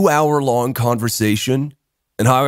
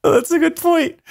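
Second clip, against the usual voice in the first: high, breathless with laughter; laughing. Retold or imitated. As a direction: concede while cracking up. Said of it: "And I was just".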